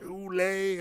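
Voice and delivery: imitating a French tourist saying "Oolé"; mock French accent. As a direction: repeat "Oolé" like the confused Frenchman. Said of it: "he was like".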